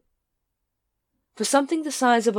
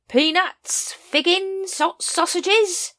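high, drawn-out hawker's whine, slow at the end; whining street vendor; 13 semitones higher, 5 dB louder, much slower than usual. Acted. Throat hawking food to the crowd, high whining cry. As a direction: cry your wares to the crowd. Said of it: "whined a voice behind them".

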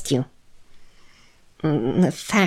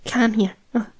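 usual speaking voice

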